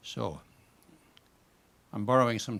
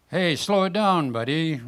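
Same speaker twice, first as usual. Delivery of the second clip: higher, louder call across the water; shout; 5 semitones higher, 6 dB louder than usual. Acted. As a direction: yell at the paddler to ease off. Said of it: "another salmon shouts".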